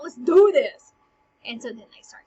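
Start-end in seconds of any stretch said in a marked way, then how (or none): 0.0-0.9 loud fired-up challenge; pumped up. Acted. Laertes accepting the duel, loud and fired up. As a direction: accept the fight with a roar.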